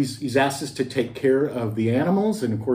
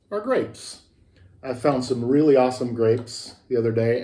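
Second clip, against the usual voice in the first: ordinary delivery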